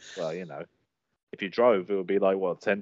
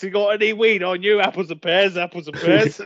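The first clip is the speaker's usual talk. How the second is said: mock cockney geezer; 4 semitones higher, 7 dB louder than usual. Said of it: "You'd be like".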